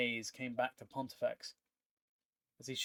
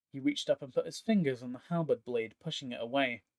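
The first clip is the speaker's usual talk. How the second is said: usual speaking voice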